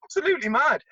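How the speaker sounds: high, quick mock-outraged quote; retold outburst. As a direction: fire it back fast and high. Retold heated exchange with the coach.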